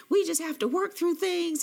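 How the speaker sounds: hammed-up angry insisting; imitating an angry boyfriend. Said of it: "he was still pissy mad".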